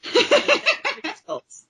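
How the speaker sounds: high, laughing repeat of the nickname. Real, not acted. Laughing as she echoes the cohost's nickname. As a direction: giggle the phrase out.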